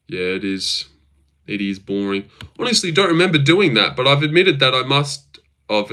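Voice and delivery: ordinary talk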